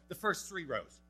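indignant disciple spelling out the obvious; exasperated Peter. Retold or imitated. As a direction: blurt it out like baffled Peter in the crowd. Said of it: "Peter sitting there going".

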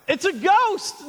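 high, drawn-out alarm; fearful cry. Acted. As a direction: cry it out slowly in acted fright. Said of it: "and cried out in fear".

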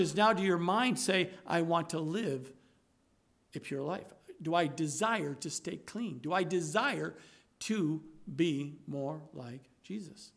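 soft, low, intimate sermon voice; hushed preaching. Acted. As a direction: draw them in close and quiet.

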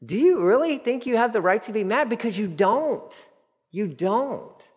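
voicing God. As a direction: voice God gently pressing Jonah, buddy. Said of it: "God's like".

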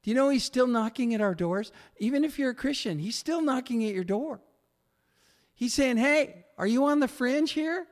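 high, fast, urgent preaching; preacher urging Christians, high and fast; fervent appeal. Acted. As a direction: press the listeners with rising urgency.

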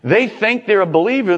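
ordinary talk, nothing marked

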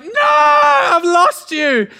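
drawn-out, high, loud wail of loss; anguished cry. Retold or imitated. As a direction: wail it as if the chick is gone. Chick run over, anguished cry.

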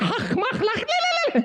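high babbling nervous laugh; nervous foreign gibberish laugh. Retold or imitated. New boy answering the kids with high nervous babble. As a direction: babble like a kid with no English. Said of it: "doing that thing".

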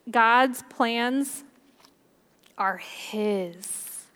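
normal talking voice

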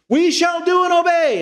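high, emphatic mock vow; crowd's pledge. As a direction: declare it like a whole crowd. Voicing the people's promise in chorus.

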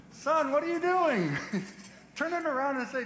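high, amused fatherly question; God's voice. Retold or imitated. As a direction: ask like a bemused father. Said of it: "God's like".

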